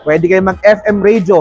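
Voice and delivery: usual speaking voice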